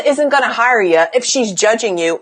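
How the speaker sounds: usual speaking voice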